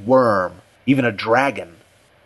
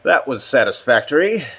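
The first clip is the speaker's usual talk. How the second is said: higher-pitched classroom verdict; judging aloud; 4 semitones higher than usual. A narrator's reading. Richardson judges his own deed aloud, like a teacher. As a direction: pronounce it like grading a presentation. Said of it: "as though he were judging a presentation in class".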